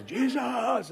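high-pitched, drawn-out scream; shrieked retold outburst. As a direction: reenact a panicked cry. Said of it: "I just screamed out".

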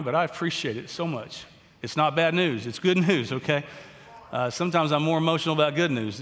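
emotional, tearful, pushing the words out; crying. Real, not acted. Pastor sharing his wife's good scan news, crying. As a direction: overcome with joy, voice catching. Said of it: "I don't know why I'm crying".

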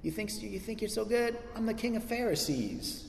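high, hushed mock brag; tongue-in-cheek boasting. Preacher as boastful Paul one-upping rivals, mock bragging. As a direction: put on a soft, high-pitched boast. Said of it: "tongue-in-cheek boasting about himself".

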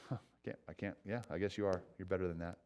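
soft, dry mock agreement; quiet irony. Jesus answers the proud crowd in soft dry irony. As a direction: let it drop, wry and hushed. Jesus voiced, ironic reply.

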